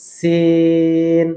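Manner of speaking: "সিন" with a very long, level ee; long alif stretch. Shown as a demonstration. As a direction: hold the vowel of "সিন" on one steady note. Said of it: "চার আলিফ টেনে বলবো".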